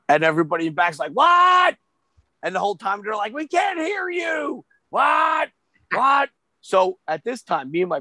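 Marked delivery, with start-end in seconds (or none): 1.1-1.8 high, loud yell from the back; mock hollered question. Back of room can't hear caller. 3.3-6.3 high, drawn-out heckle; imitated crowd holler. Crowd yelling at soft caller.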